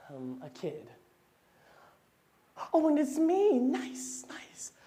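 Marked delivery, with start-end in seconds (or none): 2.7-3.6 high excited squeal; excited child. Acted. Child excited at own guess. 3.6-4.7 unvoiced soft whisper. Whispered aside.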